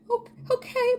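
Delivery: high, startled little okay; stunned okay. Retold or imitated. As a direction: caught off guard, squeak it out. Meek reply to neighbor.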